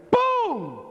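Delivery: long drawn-out "boom" falling from high pitch; imitated explosion boom. Survivor telling what fourteen people heard, an imitated booming blast. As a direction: draw the blast out slowly.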